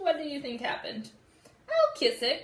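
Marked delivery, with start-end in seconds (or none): 1.7-2.4 higher, louder little sister voice; cried out. Acted. Frogolina offering to kiss the hurt thumb, high and loud. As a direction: sing it out as an eager little sister. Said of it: "cried Frogolina".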